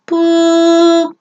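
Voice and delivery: high, steady held "boop"; one long sustained high note by voice; imitated ringing tone. Shown as a demonstration. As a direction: mimic the pitch the ear hears. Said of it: "That's what your conscious brain hears".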